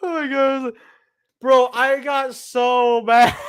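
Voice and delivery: slow, high words through laughter; real giggling disbelief at himself; laughing. Real, not acted. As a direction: talk while still cracking up. Still laughing after the punchline.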